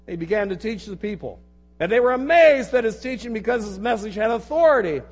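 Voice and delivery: projected, declamatory reading from the pulpit; forceful scripture reading. Real, not acted. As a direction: read the passage out to a whole congregation. Resumes reading the passage aloud.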